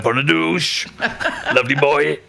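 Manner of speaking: put-on broad, singer-style Welsh accent. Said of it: "more and more [name]".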